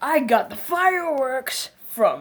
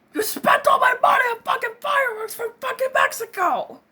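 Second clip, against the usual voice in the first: furious screaming rant; 11 semitones higher than usual. Acted. Man screaming at his friend over wasted money, furious. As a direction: rage about the fireworks.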